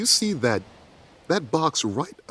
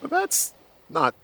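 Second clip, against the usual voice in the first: high-pitched relief. Real, not acted. Reacting to dice roll.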